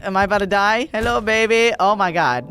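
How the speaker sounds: usual speaking voice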